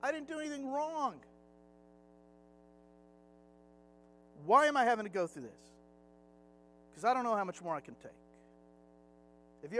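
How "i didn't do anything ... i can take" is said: David snapping and shouting at God in the wilderness; yelling at God. Retold or imitated. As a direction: let it burst out at heaven, fed up. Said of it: "he just starts yelling".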